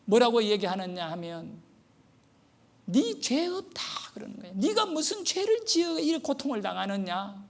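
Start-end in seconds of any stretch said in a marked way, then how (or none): 2.8-3.8 angry official. Retold or imitated. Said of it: "완전히 화가 나가지고요". 4.5-7.4 indignant official. Retold or imitated. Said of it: "완전히 화가 나가지고요".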